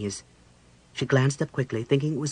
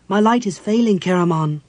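high, loud note of alarm; 6 semitones higher, 8 dB louder than usual. A narrator's reading. Cleric tells warrior her light is failing, alarmed. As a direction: warn him urgently.

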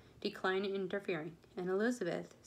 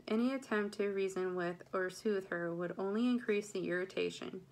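ordinary delivery, nothing marked

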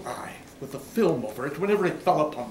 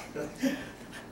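soft chuckle; 8 dB quieter than usual. Acted. Narrator chuckles at the idea.

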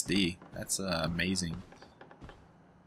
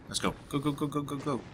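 scared horror game streamer; 7 semitones higher, 5 dB quieter than usual. Real, not acted. Running from a horror game threat.

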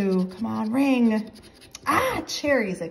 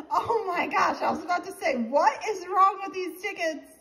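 higher voice with laughter in it; giddy, high-pitched relief after a win; excited relief; 8 semitones higher than usual. Real, not acted. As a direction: gush in relieved disbelief. Relief after finally winning.

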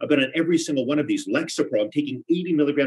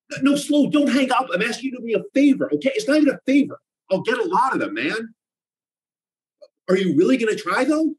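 desperate pleading; 10 semitones higher, 4 dB louder than usual. Acted. Pleading with dealer on phone.

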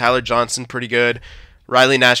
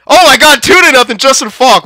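excited cheer; 12 semitones higher, 7 dB louder than usual. Real, not acted. Second goal scored, cheering.

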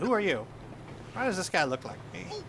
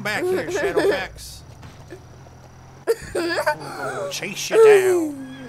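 laughing chase; 11 semitones higher, 6 dB louder, slower than usual. Pretend. Chasing the runaway horse.

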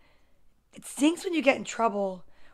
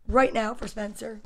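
ordinary delivery

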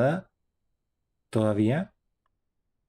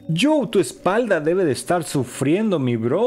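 teasing the monster; 6 semitones higher than usual. Real, not acted. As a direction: rib it about its posture. Se burla de la postura del monstruo. (burlándose del monstruo por su postura)

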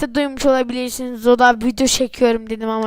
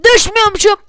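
relieved surprise; 7 semitones higher, 8 dB louder, faster than usual. Real, not acted. Relieved exclaim.

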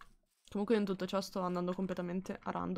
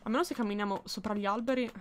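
usual speaking voice

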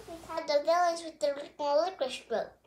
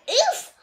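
high winded grunt with a laugh; pained oof; 11 semitones higher, 8 dB louder than usual. A narrator's reading. Roxy knocked onto her back, winded oof.

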